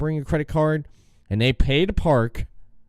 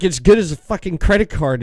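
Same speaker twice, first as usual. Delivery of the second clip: retold angry outburst; 5 semitones higher, 4 dB louder than usual. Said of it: "It just started going off on 'em".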